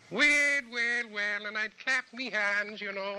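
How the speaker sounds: very high, drawn-out Irish-tinged puppet-show voice; high Irish falsetto greeting for kids; Mister Moon. Shown as a demonstration. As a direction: pitch it way up in a children's TV character voice. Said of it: "get my voice up a little higher".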